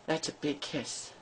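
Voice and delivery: soft breathy whisper of "big kiss". Real, not acted. Mom to her dog, repeating it in a whisper. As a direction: hush it right down, all breath.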